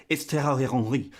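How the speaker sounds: mock French pronunciation of THIERRY HENRY; French accent. YouTuber imitating French people correcting the name, French accent. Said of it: "The French people are saying".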